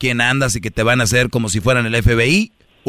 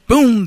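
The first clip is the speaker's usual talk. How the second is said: loud pum; 11 semitones higher, 4 dB louder than usual. Real, not acted. Sound effect for sudden change.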